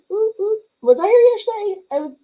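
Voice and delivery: high, loud excited cheer, then quick question; excited whoop. Real, not acted. Cheering greeting on stream.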